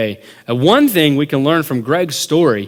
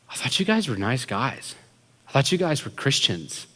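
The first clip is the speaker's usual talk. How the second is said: soft, hurt disbelief; quiet reproach; 7 dB quieter than usual. Retold or imitated. Wounded friend facing the pranksters, soft reproach. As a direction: say it softly, as if let down.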